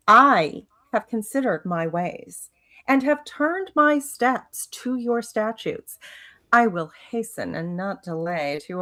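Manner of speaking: psalm read out with mock devotion; sarcastic reading. Reading a psalm to the study group, sarcastic. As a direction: recite it piously, dripping with sarcasm. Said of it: "I detected a bit of sarcasm in that".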